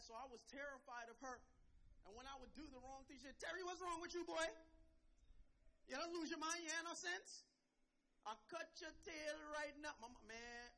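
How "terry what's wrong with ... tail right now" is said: loud, raised-pitch Trinidadian mother; mock-angry Trinidadian telling-off; Trinidadian mom scolding. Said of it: "she's an island lady from Trinidad".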